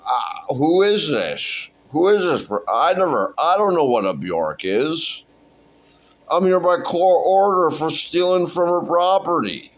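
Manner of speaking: high-pitched hammed-up bewilderment; exasperated protest. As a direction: plead innocence in mock outrage. Character protests not knowing [name].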